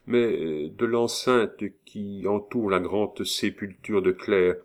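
usual speaking voice

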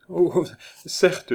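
high, cajoling agreement; smooth wheedling tone. A narrator's reading. Neighbour humouring him while hiding laughter, wheedling. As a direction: agree in an exaggeratedly honeyed way. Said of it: "dit-elle d'un ton patelin".

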